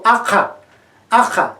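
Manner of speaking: Ajá with both a vowels wide open; open a demo. Shown as a demonstration. Teacher models Ajá for listeners, deliberate. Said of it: "Yo digo".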